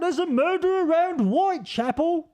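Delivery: a Victorian Londoner warning of a murder; mock British accent. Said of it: "the Brits say brother".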